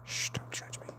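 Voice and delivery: hushed whisper aside. Real, not acted. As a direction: whisper it like a secret.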